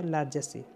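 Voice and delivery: लार्जेसी with a j sound and final "see"; correct pronunciation. Shown as a demonstration. Teacher correcting the reading of लार्जेसी, correct pronunciation. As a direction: say लार्जेसी as "lar-juh-see". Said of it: "इसे लार्जेसी पढ़ेंगे".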